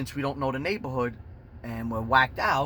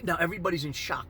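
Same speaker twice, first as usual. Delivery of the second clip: normal talking voice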